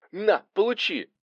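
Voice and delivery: high, effortful taunt; high-pitched fighting yelp; combat cry. A narrator's reading. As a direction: cry out as you strike. Arseny attacking during the fight.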